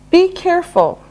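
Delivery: normal talking voice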